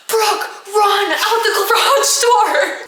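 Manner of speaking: high, loud, drawn-out warning; mock frantic yell. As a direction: cry out an urgent escape order, hammed-up. Mother yelling while holding attacker back.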